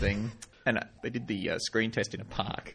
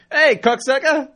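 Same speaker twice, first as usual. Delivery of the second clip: much higher, louder, drawn-out yell; mock shout; 12 dB louder than usual. Greeting an ex-girlfriend with a crude shout, loud and high. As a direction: hail an ex across the room. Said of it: "and going".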